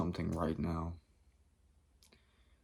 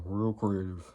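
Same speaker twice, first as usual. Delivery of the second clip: "Real creative" said flat and dry, sarcasm on "Real"; dry sarcastic repeat; slower than usual. Real, not acted. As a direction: repeat it deadpan, mocking the title.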